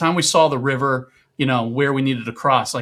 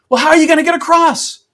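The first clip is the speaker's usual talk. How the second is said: high, loud question over the water; ranger yelling; 9 dB louder than usual. Retold or imitated. Ranger shouts across the raging river to the hiker.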